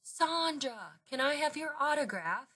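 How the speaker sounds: high, eager fan call; excited calling out. Acted. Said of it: "Missus Martinez called out".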